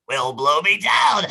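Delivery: a hint of Popeye's sailor growl, slow; lightly voiced Popeye. Popeye making lewd comments to Olive, hint of a sailor voice. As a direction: toss it out like Popeye leering at Olive.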